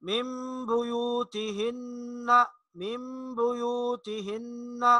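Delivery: मिम बुयूतिहिन्ना soft and slow with ghunna; soft recitation. Shown as a demonstration. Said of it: "इसी तरह". (मिम बुयूतिहिन्ना धीमी तिलावत)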